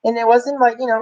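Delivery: ordinary delivery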